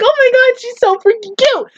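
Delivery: high-pitched gushing over something cute; retold freak-out. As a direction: squeal it like a fan reliving delight. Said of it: "I freaked out because I was like".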